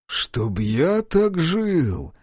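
very slow, high mock-envious exclamation; smirking quip. A narrator's reading. Narrator joking about the lavish office, slow smirk. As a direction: savour the joke, drawn out. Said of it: "усмехнулся я".

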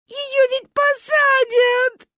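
very high, loud, stretched lament; sobbing child's wail. A narrator's reading. Manya dreads prison for Ba, high sobbing wail. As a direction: bawl it slowly like a heartbroken girl. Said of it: "рыдала Маня".

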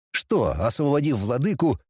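ordinary delivery, nothing marked

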